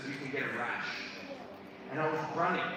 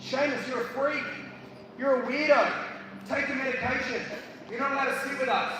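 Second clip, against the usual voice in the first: imitated taunts; 7 semitones higher than usual. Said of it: "they would always say hurtful comments".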